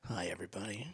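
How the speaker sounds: very soft, low public radio greeting; hushed, low-key radio host parody; NPR-style hush. Acted. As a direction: greet listeners in a mock NPR murmur. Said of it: "when did we join N P R".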